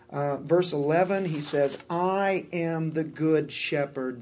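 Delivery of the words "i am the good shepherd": slow, weighty reading of I am the good shepherd; slow solemn quote. Acted. Preacher reads Jesus' claim to congregation, slow and solemn. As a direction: let each word land slowly.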